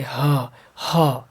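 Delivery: isolated ह, sounded twice from the throat; throat sound demo. Shown as a demonstration. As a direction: push out ह as an open "haa" from the throat. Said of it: "ह कंठ से आता है सीधे".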